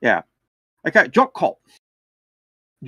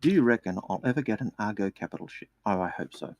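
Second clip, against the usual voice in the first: whisper; 4 semitones lower, 10 dB quieter than usual. Pretend. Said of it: "I have to whisper this one".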